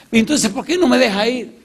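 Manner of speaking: high, strained voice begging to be let go; a cornered man whining to get past; bandit pleading. Retold or imitated. Bandit begs the pastor to move, high and strained.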